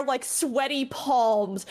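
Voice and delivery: normal talking voice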